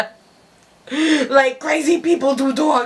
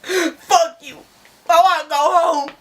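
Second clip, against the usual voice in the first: whiny; 3 semitones higher than usual. Retold or imitated. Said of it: "Jared goes".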